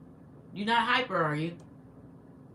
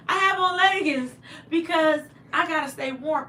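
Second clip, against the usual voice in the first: playful; 7 semitones higher, 5 dB louder than usual. Real, not acted. Showing off her leggings.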